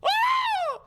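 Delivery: very high, wailing shriek; keening banshee wail; banshee scream. Acted. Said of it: "screaming like a banshee".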